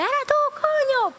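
high-pitched accusing yell with a swear; acted cursing shout. Man cursing the snorer before hitting him, angry shout. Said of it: "Pide mala palabra otra vez".

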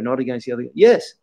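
ordinary talk, nothing marked

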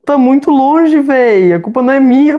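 high, loud protest; panicked yelling. Real, not acted. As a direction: yell in panic. Panicked chase in the game.

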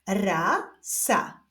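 stretched first syllable, pause, then second; drawn-out, divided syllables; syllable reading. Shown as a demonstration. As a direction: read it slowly as two separate syllables. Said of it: "doczytujemy sylabę różową".

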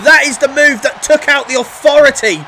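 wrestling commentator. Acted. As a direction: call every move like it's live. Calls the match live.

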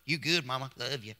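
soft, offhand quick words to mom; casual brush-off. Retold or imitated. Son giving his mom a lazy side hug, offhand and soft. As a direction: toss off a lazy goodbye to mom. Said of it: "I ain't talking about no side hug".

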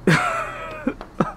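high breathy burst of laughter; a squeaky, airless giggle. Real, not acted. Laughs at own joke.

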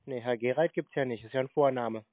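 normal talking voice

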